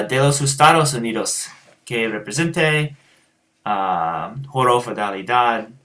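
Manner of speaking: halting Spanish in an American accent; Spanish words, plainly American vowels. Shown as a demonstration. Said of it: "I have just such an American accent".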